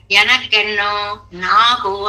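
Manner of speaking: slow, with నాకు pressed hard; stress on నాకు. Shown as a demonstration. Preacher explaining the verse, slow stress on నాకు. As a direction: lean slowly on the word నాకు. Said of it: "ఏ నాకు అని ఎందుకండి ఇంత ఒత్తి చెప్తున్నారు".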